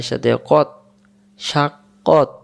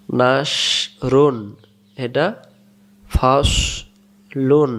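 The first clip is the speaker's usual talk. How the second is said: very slow, stretched syllables; slow model reading; much slower than usual. Shown as a demonstration. Teacher reading words slowly to the class. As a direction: stretch each word out for learners. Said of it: "teacher reads words for students".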